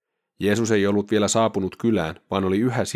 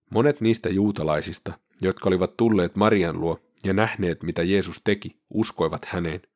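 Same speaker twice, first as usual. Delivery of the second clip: ordinary talk, nothing marked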